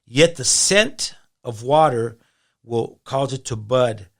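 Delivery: loud, slow, declaimed Bible verse; emphatic scripture reading. Acted. Guest reading Job to the host, emphatic preaching. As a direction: proclaim it slowly, hitting key words hard.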